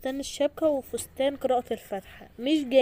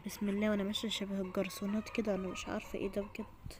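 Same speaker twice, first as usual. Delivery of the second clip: unvoiced nervous whispered aside; 7 dB quieter than usual. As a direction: whisper it to yourself. (همس بتوتر)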